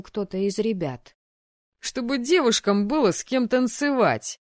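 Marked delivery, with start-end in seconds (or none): 1.8-4.4 high, loud cheeky answer; joking student. A narrator's reading. Вольт jokes to classmate about the ball, amused and loud. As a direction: call it out as a joke. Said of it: "ответил ему Вольт и засмеялся".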